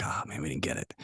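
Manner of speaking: near-whispered, deflated aside; retold disappointment, hushed and low; dejected murmur. Athlete recalling his dread at the manager's call, deflated murmur.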